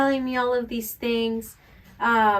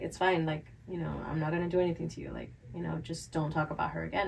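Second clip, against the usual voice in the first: quiet warning; 6 semitones lower, 13 dB quieter, faster than usual. Retold or imitated. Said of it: "she was just like".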